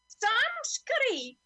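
high, slow, stretched-out call of the name; drawing out Sam Scurry high and loud; cried out. Acted. Salvation Army dame calling after the gull, a high drawn-out cry. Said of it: "she cried".

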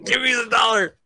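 put-on high, loud kid brother whine; whiny brother demand. Impatient brother demands his dollar, loud whine. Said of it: "He's like".

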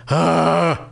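sudden loud disgusted groan. Real, not acted. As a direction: let out an exasperated groan. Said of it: "I groan".

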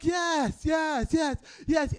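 high, eager string of agreement; hyper-agreeing. Retold or imitated. Improv student yes-anding a threat, over-eager agreement. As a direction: agree with mock enthusiasm. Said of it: "yes-anding and hyper-agreeing".

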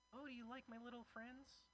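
high sing-song imitation of the woman; mock cooing date voice; imitating the date. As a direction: put on a sweet high voice for her. Date's quote, high girly voice.

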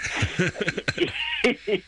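squeaky laugh bursting out; high burst of laughter. Real, not acted. Laughing at own remark.

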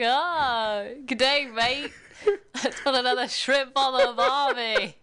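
high, loud, hammed-up Australian accent; mock Australian accent. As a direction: quote a movie fool doing cheery Australian. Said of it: "Australian accent, or so, or maybe another accent".

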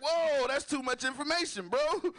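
high, recoiling exclamation; mock alarm; shocked. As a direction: pull back in alarm. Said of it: "I was like".

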